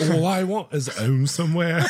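high, loud mock Cockney sung-speak through giggles; My Fair Lady Cockney. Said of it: "I do Cockney like My Fair Lady".

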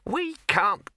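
high, slow, drawn-out sour rabbit. Acted. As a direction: stretch it out sourly in a high voice. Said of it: "said the rabbit sourly".

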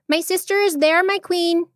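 high-pitched small monkey voice; little monkey. A narrator's reading. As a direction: address a queen in a small, high voice.

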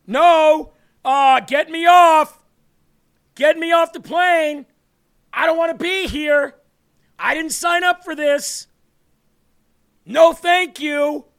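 loud, high, frantic screaming; panicked passenger. Pretend. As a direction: yell to get off the plane in panic. Panicked passenger in acted skit.

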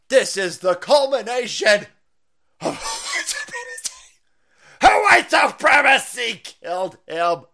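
loud high mock outrage. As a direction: shout it like a preacher. Mocking race-hustler outrage.

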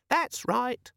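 very high, smug little squeak; squeaky demon voice. Acted. The demon contradicting Vimes, smug squeak. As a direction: answer like a smug gadget.

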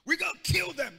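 high, loud street talk; street language. Shown as a demonstration. Preacher doing the street version, loud and high. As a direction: bark it like a street tough. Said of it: "That's street language".

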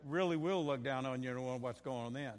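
ordinary talk

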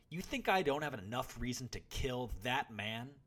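loud, high outrage; boy nearly yelling, seething with hatred. A narrator's reading. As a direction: throw the angry question at him. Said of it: "The boy seethed".

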